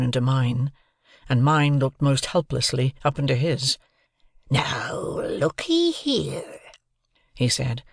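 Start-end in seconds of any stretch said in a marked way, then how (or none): 4.5-6.6 slow, drawn-out rough convict role; menacing convict. A narrator's reading. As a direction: draw the words out to threaten a child. Convict threatening the boy.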